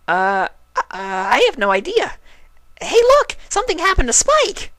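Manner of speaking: very high, loud, bubbly squeal of surprise; startled party pony shrieking at her friend; Pinkie Pie voice. A narrator's reading. Pinkie startled by Applejack, squealing high.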